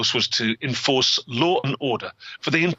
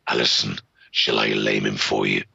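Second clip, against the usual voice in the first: talking dog. Acted. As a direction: growl it low like a hellhound. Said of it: "and the dog talked".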